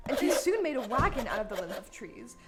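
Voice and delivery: ordinary talk, nothing marked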